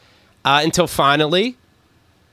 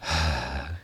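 long soft exhale; imitated dad's sigh; 13 semitones lower, 11 dB quieter than usual. Imitating the co-host's sighing dad, soft mock sigh. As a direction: let out a put-on, weary dad sigh. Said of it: "sounds like your dad sighing".

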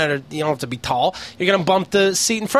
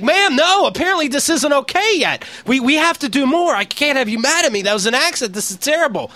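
over-eager pleading; 6 semitones higher than usual. Retold or imitated. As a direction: beg her like a desperate fan.

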